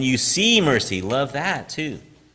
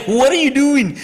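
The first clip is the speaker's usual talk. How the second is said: high-pitched, louder mock outcry with a laugh; retold alarmed exclamation; 13 semitones higher, 5 dB louder than usual. Teacher to student throwing a Coke bottle, retold alarmed exclamation. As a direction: blurt it in mock alarm at the blunder.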